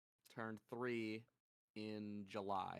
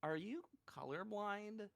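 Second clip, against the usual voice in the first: high-pitched, questioning child imitation; five-year-old girl; 8 semitones higher than usual. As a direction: mimic a small kid's incredulous question. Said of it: "this girl is five years old".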